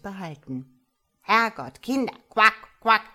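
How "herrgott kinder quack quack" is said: put-on loud, croaky old frog voice; an old mother frog calling her kids loudly. A narrator's reading. Old mother frog calling her children, loud croaky scolding. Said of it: "sagte der alte Frosch".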